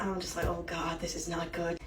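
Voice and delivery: a hushed, sick little oh god; quiet dread under the breath; muttered dread. Retold or imitated. Muttering to herself while nauseous at the table. Feeling sick near the alpha.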